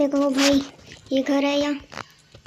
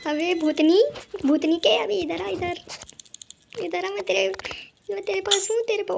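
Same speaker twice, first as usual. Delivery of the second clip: higher mocking taunt; 5 semitones higher than usual. Real, not acted. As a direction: tease the ghost to come over. Taunting the ghost granny. (ऊँची आवाज़ में चिढ़ाना)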